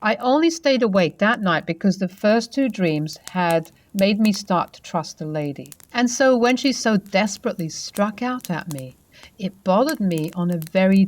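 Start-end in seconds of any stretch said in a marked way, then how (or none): none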